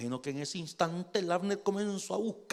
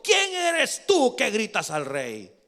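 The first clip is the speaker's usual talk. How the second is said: raised, slow demand; indignant challenge; 4 semitones higher, 4 dB louder, slower than usual. Acted. Abner answering the one shouting at the king, indignant challenge.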